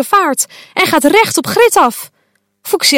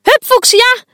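much higher, loud cheer; cheering call; 12 semitones higher, 4 dB louder than usual. Acted. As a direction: yell support across the field. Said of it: "roepen Akabahar".

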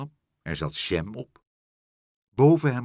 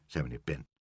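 ordinary delivery, nothing marked

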